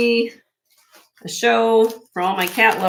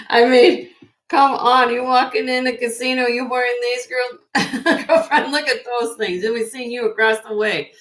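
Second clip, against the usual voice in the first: sassy gushing; 5 semitones higher, 4 dB louder than usual. Real, not acted. Host gushing to viewers about flashy casino earrings.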